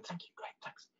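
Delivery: barely audible, whispered aside; hushed teacher's thank-you. Retold or imitated. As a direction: whisper thanks to someone on the phone. Teacher quietly thanks student on phone.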